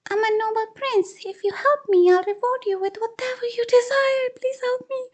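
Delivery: very high, pleading little creature voice; squeaky mongoose prince. Acted. Mongoose begging the man to save him, high and pleading. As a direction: beg like a tiny noble animal.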